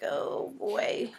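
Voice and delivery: breathless mock dread; whispered dread. The pizza eater sensing guilt, whispered dread. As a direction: whisper it like trouble's coming. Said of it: "you're like".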